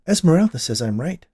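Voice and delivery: high, loud proud declaration. A narrator's reading. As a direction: announce it proudly, as if vindicated. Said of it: "Dolores declared proudly".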